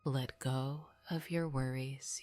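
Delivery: ordinary delivery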